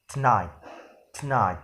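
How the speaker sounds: tonight said as "t'night", the first vowel dropped. Shown as a demonstration. Teacher showing the deleted vowel, clipped to one beat. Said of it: "But when deletion insert to this word".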